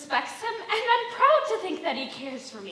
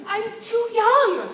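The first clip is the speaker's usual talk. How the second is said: high, upset protest; 8 semitones higher, 9 dB louder, slower than usual. Acted. Refusing an unwanted proposal.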